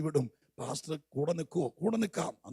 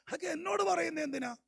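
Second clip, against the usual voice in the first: much higher, incredulous why-tell-me; exasperated question; 13 semitones higher than usual. Retold or imitated. God asking why the crying is brought to him, exasperated. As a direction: toss the question back in disbelief. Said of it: "ആ ടോണിലാ ദൈവം ചോദിക്കുന്നത്".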